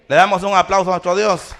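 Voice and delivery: loud call for applause; rallying call. Real, not acted. Preacher asking the church to applaud God, loud rallying call. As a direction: project it out to the whole congregation.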